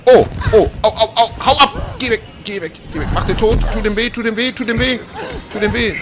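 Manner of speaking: high frantic shrieks to get away; hysterical panic. Real, not acted. Player attacked by an alien, hysterical shrieks. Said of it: "Ich werd hysterisch".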